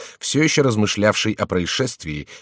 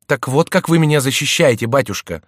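raised, higher, exasperated reproach; irritated cry; 7 semitones higher, 6 dB louder than usual. A narrator's reading. Stepson reproaching his stepfather for poor defence, irritated. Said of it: "вскричал раздражённый Мелитор".

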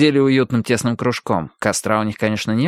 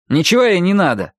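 curt refusal, higher, with a louder start; curt retort; 7 semitones higher, 4 dB louder than usual. A narrator's reading. Mikey answers for Alli over an invitation, curt retort. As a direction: cut the discussion off firmly. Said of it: "отрезал Майки".

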